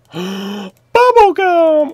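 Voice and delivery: high slow drawn-out bubble gum; excited gasp. Pretend. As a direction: gasp then stretch it out. Gasp then excited exclaim.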